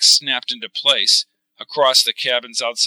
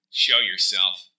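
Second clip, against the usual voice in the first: low, slow, steady challenge; stern low voice; 4 semitones lower, 3 dB quieter, slower than usual. Acted. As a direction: call out the intruder, lower. O'Neill challenges hatch.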